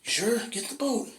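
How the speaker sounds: very high, pleading insistence; insistent rescuer. Acted. Boat rescuer pleading with the stubborn homeowner, very high.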